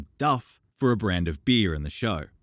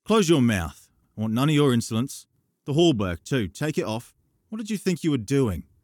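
higher, louder angry rebuke; stern scolding; 7 semitones higher, 5 dB louder than usual. Acted. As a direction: tell the boy off hard. Dunk scolding the boy.

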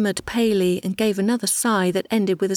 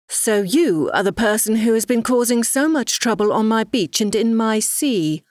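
usual speaking voice

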